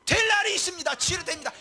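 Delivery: high-pitched declaration of faith; proclaiming. Acted. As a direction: proclaim hope to the sick. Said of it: "이거를 외치는 게 목사라는 거예요".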